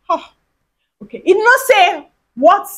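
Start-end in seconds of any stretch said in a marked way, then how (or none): none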